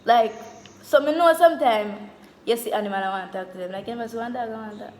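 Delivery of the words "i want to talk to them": usual speaking voice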